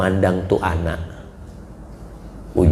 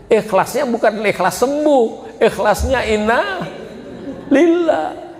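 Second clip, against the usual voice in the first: mocking, rising to a high inna lillah; preacher mocking children who give up on parents; sarcastic; 12 semitones higher than usual. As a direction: sneer at the false sincerity with a laugh. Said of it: "Artinya anak nggak mau ngerawat lagi".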